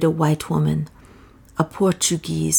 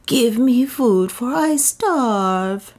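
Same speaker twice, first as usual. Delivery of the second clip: high, slow, pleading plea for food; piteous; 7 semitones higher, much slower than usual. A narrator's reading. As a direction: beg as if starving, voice lifting and stretched. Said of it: "she added in a piteous tone".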